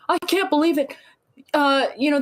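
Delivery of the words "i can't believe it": high-pitched mock outrage; put-on indignant complaint; mocking complainers. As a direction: imitate someone making a fuss, pitched up. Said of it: "people who are like".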